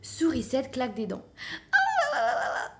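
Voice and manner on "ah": small shivery whimper, breathy and soft. A narrator's reading. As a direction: moan it, cold and miserable. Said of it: "gémit-elle".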